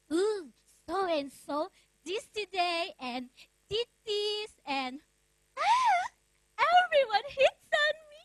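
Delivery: mock schoolgirl whine, climbing high and loud; imitating girls' phony grievance, building to a high squeal; fake complaining. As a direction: gush a put-on complaint, drawn out then shrieky at the end. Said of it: "Sure, they complained, but most of it was fake".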